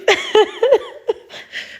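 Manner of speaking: petit rire aigu au milieu des pleurs; rire mouillé de larmes. Real, not acted. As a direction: rit nerveusement en pleurant. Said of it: "pourquoi je pleure?". (rire en larmes)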